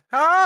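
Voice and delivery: sharp high burst of laughter; loud laugh. Real, not acted. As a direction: laugh out loud in one bark.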